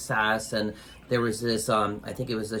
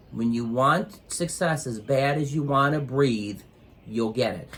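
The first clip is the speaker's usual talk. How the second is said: slow, weighty, every word stressed; slow emphatic; slower than usual. Real, not acted. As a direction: lay the lesson down slowly. Repeats the lesson slowly.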